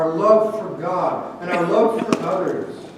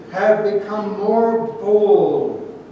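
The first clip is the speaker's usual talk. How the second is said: very slow, heavy "more bold"; stress on "more bold"; 3 dB louder, much slower than usual. Shown as a demonstration. Preacher showing the verse's clear emphasis, stressing "more bold". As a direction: stretch "more" and "bold", punching both. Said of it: "the emphasis is clear".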